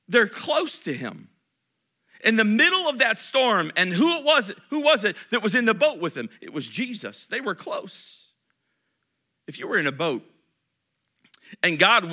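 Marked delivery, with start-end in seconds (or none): 2.2-6.3 louder, higher, driving the question home; preaching loud. Real, not acted. Pastor pressing the congregation, loud and high. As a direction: raise the voice and push the question.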